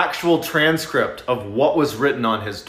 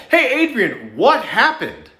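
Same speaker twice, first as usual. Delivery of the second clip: understated calling out to a son; mild narrated yell; 8 semitones higher, slower than usual. A narrator's reading. Mom calling up to her son from downstairs, mild yell. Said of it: "hears his mom from downstairs yell".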